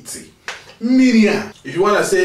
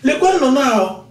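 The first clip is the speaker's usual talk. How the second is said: excited pointing out; 9 semitones higher, 5 dB louder than usual. Pretend. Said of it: "you say something like this".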